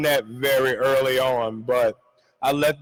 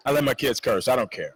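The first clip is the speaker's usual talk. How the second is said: ordinary talk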